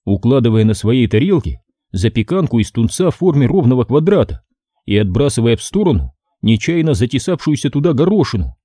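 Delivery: plain reading voice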